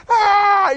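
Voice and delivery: high, loud mock scream of a scared boy; scared child's cry. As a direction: yelp like a terrified kid on a tube. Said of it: "He's, like, crying".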